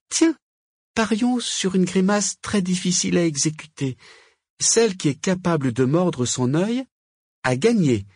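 high-pitched witch proposing a wager; wyzywająca wiedźma. A narrator's reading. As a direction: throw down a challenge in a high character voice.

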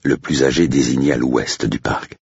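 usual speaking voice

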